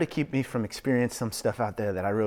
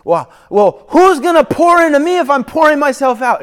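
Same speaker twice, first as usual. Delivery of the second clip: high, loud, pleading whine; worn-out believer asking God, pleading; anxious plea; 15 dB louder than usual. Retold or imitated. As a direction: beg for help, near frantic.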